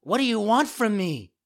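put-on high, loud puppet child; alarmed. A narrator's reading.

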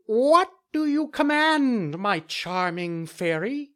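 very high, slow bird voice; falcon voice. Acted. Falcon speaks in story.

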